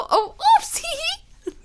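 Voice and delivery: mock oopsie. Mock after answering phone.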